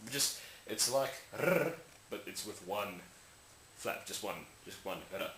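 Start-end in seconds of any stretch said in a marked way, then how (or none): 1.4-1.7 r as a rolled tongue trill. Shown as a demonstration. Likening the flap to a trill, rolled r. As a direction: roll out r on the tongue. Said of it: "It's a trill".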